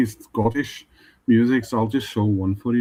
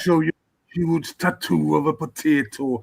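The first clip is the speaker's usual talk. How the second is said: put-on Welsh accent; 3 semitones higher than usual. Friends praise [name]'s take on Rod, a Welsh accent. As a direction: speak like a Welsh comedy character. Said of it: "That is a good Welsh accent".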